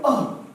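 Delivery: short, sharp high "oh"; sudden loud burst from the belly; punched-stomach grunt. Shown as a demonstration. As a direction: grunt as if hit in the gut. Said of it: "imagine that you're hit in the stomach".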